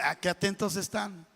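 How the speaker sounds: usual speaking voice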